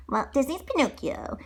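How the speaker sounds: usual speaking voice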